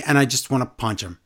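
ordinary talk

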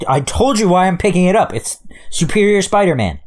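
exasperated, high-pitched insistence; defensive protest. Real, not acted. Host defending his comic pick, exasperated. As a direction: push back, pitched well up.

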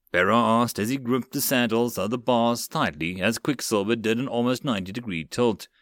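ordinary delivery, nothing marked

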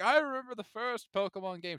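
put-on old man voice. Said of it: "the old man card".

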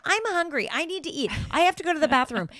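high, whiny-child mock complaint; Ramona. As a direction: lightly imitate a needy woman announcing herself. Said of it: "They're all yelling. Oh, and Ramona walking".

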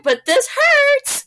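high, drawn-out pained whine, very slow. Retold or imitated. Mother in labor pleading with staff, slow pained whine. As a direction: stretch the words out like it really hurts. Retold pain during labor.

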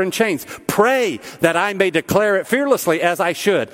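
high, emphatic pulpit proclamation; impassioned preaching. Real, not acted. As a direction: build to a ringing exhortation for the congregation. Preaches scripture and point with force.

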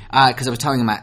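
ordinary talk

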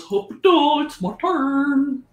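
a not-so-smart copy of Victor; slightly wrong copied voice. Acted. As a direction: mimic another player's voice, a bit off. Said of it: "why are you using my voice?".

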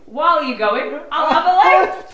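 laughing through a loud high quote; loud laughing punchline. Retold or imitated. Telling the Gyro Jim story, loud laughing punchline. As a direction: blurt it out between laughs.

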